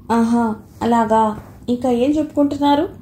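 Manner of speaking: curious. A narrator's reading. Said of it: "ఆసక్తిగా అడిగింది". (నెమ్మదిగా, ఆసక్తిగా)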